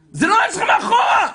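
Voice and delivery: full-force high shout; frantic yelling. Retold or imitated. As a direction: scream the warning at the other driver. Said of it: "צועק לו". (צעקה גבוהה בכל הכוח)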